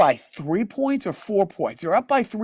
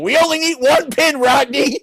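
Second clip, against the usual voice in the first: raised, louder mock ad-guy plea; commercial bowler voice; 7 semitones higher, 4 dB louder than usual. Retelling a beer ad to his co-host, mock pleading bowler. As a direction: ham up the beer-ad bowler begging for one pin. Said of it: "the old Rodney Dangerfield commercial".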